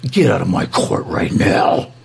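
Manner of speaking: low, slow, whispered menace; judge quote, hushed. Retold or imitated. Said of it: "and he's all".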